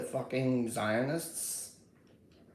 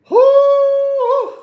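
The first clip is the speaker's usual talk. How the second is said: sung whoo; 16 dB louder than usual. Real, not acted. Hyped reaction to the stream.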